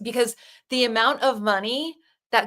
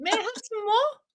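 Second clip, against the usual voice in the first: high, rising British orphan plea; 11 semitones higher, 4 dB quieter than usual. Acted. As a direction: beg in a put-on British child voice. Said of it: "when you do your British voice".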